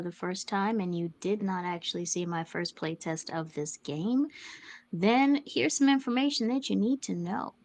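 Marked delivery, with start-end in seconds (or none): none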